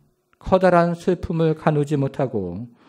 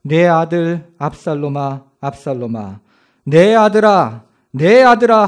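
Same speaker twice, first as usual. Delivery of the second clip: king crying aloud for his son; loud lament; 5 dB louder than usual. Acted. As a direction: call out to the lost son, building to a wail. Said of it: "큰 소리로 부르니".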